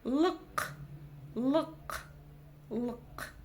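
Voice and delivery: "лк" with a short ы and uvular k; isolated guttural "лк", repeated with pauses; throat-clearing K. Shown as a demonstration. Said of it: "отхаркнуться через букву".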